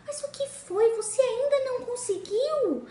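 high, concerned sister; the sister checking in anxiously. Acted. Sister arrives asking.

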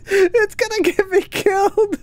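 breaking character giggling. Real, not acted. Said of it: "I can't stop laughing".

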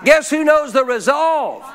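preaching shout. Real, not acted. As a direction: build to a fervent shout. Sermon climax, rising fervour.

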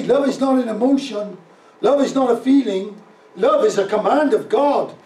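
loud, high, emphatic preacher's delivery; forceful preaching. Real, not acted. Pastor urges congregation to love the unlovely, preaching at full force. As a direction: pound out each statement as a command.

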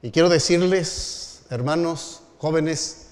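put-on preacher addressing the young brethren with fervor; preaching. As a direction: build the sermon to its appeal. Preacher builds to appeal.

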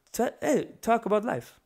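high mock Italian old man's accent; Italian-Middle Eastern accent. Three old Italian men chatting over coffee, mock Italian accent. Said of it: "I kinda make my Italian Middle Eastern".

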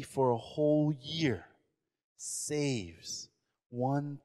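slow and soft, savouring each word; slow build-up. Acted. Preacher to the congregation, slow and savouring. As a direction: drag it out, building the value. Building up the thousand dollar tie.

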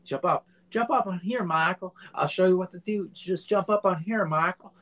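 mock stuntman urging him up; imitating the stunt guy. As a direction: egg him on like an eager stunt coordinator. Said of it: "And he's".

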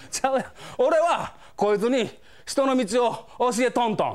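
slow, half-laughing thug excuse; yankee laughing talk. Retold or imitated. Delinquent excusing himself to the senior clerk, laughing. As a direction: play it off with a thug's sheepish laugh. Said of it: "ヤンキー独特のなんか笑いながら".

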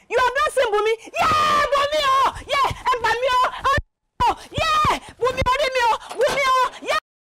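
high-pitched screaming lament; wailing in shock. Acted. Parent hears daughter is missing, wailing. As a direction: cry out the name in shrill panic.

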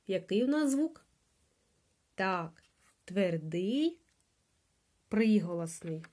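usual speaking voice

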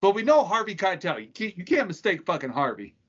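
ordinary talk

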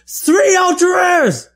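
high, slow excited shout. Real, not acted. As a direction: yell it out in delight. Excited at the pulls.

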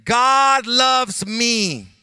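slow, loud, stressing God; slow call and response. Shown as a demonstration. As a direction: lead the crowd, each word spaced. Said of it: "Say with me".

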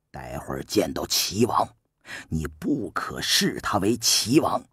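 a shrewd minister calmly coaching, voiced low and soft; Su Qin's voice. Su Qin calmly instructs the young prince, low and soft.

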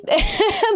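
laugh. Real, not acted. Embarrassed laugh after emotion.

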